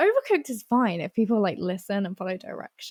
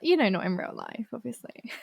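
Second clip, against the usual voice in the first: usual speaking voice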